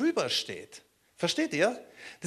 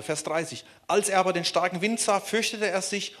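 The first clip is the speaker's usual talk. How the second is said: ordinary delivery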